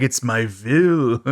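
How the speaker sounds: slow mock-German delivery with "will" as "vill"; Creon's German accent. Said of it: "Anytime he talks about his will".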